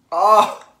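loud high startled cry; surprised. Real, not acted. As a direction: blurt it out, shocked. Tasting a bad bean.

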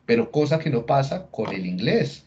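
usual speaking voice

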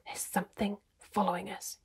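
hushed, very soft warning; low voice. A narrator's reading. Trumpkin warns the party they are being followed, low and hushed. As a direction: drop to a near whisper so only they hear. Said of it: "he said in a low voice".

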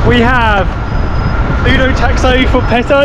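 high, loud mock calling out; hailing a taxi. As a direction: sing out for your taxi.

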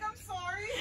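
laughing. Real, not acted. Breathless from laughing.

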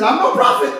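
high, loud imitation through laughter; Mother Ada. Pastor re-telling the church mother's confession, laughing high imitation. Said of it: "She said".

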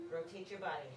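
normal talking voice

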